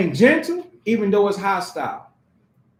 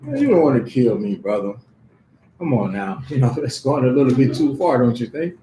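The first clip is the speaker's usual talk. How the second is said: calm reassurance; 11 semitones lower than usual. Retold or imitated. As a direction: talk the angry man down, low and easy. Calm reply to a screaming man.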